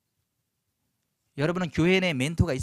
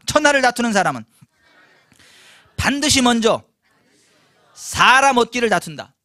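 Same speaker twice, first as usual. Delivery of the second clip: loud group recitation; 5 semitones higher, 13 dB louder than usual. Shown as a demonstration. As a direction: lead the crowd to repeat it loudly. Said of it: "따라서 하십시다".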